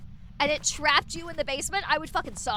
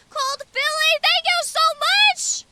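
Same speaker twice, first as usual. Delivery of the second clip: excited squeal; 12 semitones higher, 9 dB louder than usual. Real, not acted. Squeals thanks for gifted subs.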